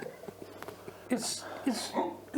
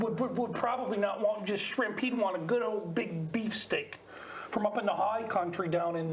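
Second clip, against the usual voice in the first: put-on Australian accent for improv; Aussie accent; 3 semitones lower than usual. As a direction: talk like an Aussie bloke. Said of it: "Give us an Aussie accent".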